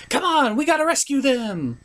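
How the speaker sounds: rally the friends in a bright high voice; high pony rallying. Acted. Twilight urging the friends to rescue the legends, high and eager.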